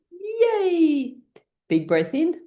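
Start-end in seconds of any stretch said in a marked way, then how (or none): 0.1-1.2 high, long-held cheer. Shown as a demonstration. Cheering once more with the class, high cheer. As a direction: sail up high and hold the yay.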